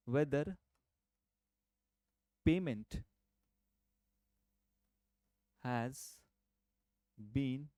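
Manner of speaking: slow dictation, word by word with long pauses. Shown as a demonstration. Teacher dictating the definition for students to copy, slow word by word. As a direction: read it out slowly so students can write. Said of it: "इसको आप पहले तो definition लिख लो".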